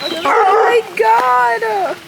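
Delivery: high, loud, drawn-out delight; surprised squeal. Real, not acted. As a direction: squeal at the sight of the dogs. Sees the fierce guard dogs.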